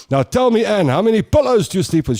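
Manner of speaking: a hint of a brisk doctor quizzing a caller; mock radio doctor. Radio professor questioning the mother, lightly voiced brisk doctor. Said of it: "And he'd go".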